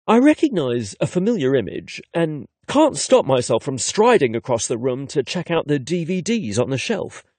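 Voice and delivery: ordinary delivery, nothing marked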